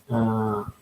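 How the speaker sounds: ordinary delivery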